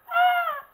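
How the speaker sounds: high piercing scream imitation; imitated scream. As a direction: shriek like you got jumped. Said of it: "a face recording of me going".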